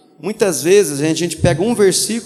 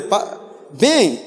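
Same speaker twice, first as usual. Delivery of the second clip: lightly voiced pastor; 6 semitones higher than usual. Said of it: "falou assim pra esposa dele".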